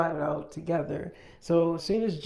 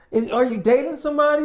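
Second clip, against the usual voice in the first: high, loud, fast accusation; shocked demand; 9 semitones higher, 8 dB louder, faster than usual. Retold or imitated. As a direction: blurt it out in shock. Team demands to know.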